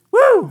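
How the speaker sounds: loud high woo of joy; high exultant whoop; joyful whoop. Real, not acted. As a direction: let out a whoop of praise. Joyful shout in worship.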